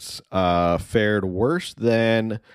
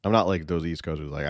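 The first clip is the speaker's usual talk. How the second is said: usual speaking voice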